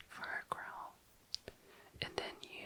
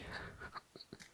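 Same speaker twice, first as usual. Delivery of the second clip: whispered giggle; 7 dB quieter than usual. Real, not acted. Laughs at own cheating joke.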